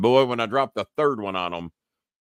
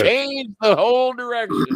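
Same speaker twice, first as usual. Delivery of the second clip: excited exclamation; 11 semitones higher, 4 dB louder than usual. Real, not acted. Excited reaction.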